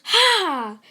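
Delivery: a burst of pain as the arm comes back; short yell; sudden cry. Acted. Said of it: "As he says".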